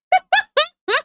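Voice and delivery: sudden high-pitched burst of laughter; high squealing laugh. Real, not acted. Laughing at chat after a tease, high squealing laugh.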